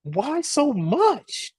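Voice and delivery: very high, stretched-out mock complaint; outraged customer. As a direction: whine the price back slowly like a stunned customer. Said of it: "They go".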